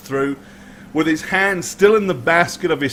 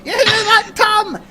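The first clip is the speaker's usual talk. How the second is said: shrieking wild woman. Acted. Scruffy mother crows to Tom about no wealth, high gleeful shriek. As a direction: shriek it gleefully through a laugh.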